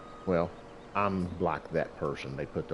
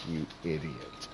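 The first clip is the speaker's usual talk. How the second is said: muttered self-scolding; 3 semitones lower, 7 dB quieter, slower than usual. Retold or imitated. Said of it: "I was like".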